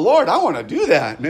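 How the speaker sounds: high, loud eager blurt; eager Peter. Retold or imitated. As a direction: jump in like an excited kid. Peter eagerly asks to walk.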